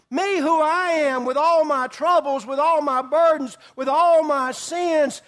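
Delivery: a hammering, fervent sermon climax; full-force preaching. Acted. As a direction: preach it out, pressing the point home.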